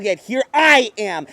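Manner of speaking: usual speaking voice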